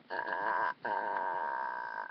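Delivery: low, rattling inhaled croak; tight-throated inhaled rattle; Grudge croak. Shown as a demonstration. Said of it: "then try doing the grudge sound".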